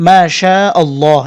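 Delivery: মাশাআল্লাহ said slowly, each long vowel held; slow correct recitation. Shown as a demonstration. Teacher correcting মাশাআল্লাহ slowly for students. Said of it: "এভাবে বলতে হবে".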